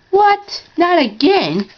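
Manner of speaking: upset shock at the news about a friend; alarmed disbelief. Acted. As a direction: sound shocked that it happened again. Hearing Percy had an accident.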